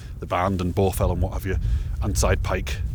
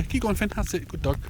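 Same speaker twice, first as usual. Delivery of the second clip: talking to dog; 11 semitones higher, 4 dB quieter than usual. Real, not acted. Encouraging dog on steep path.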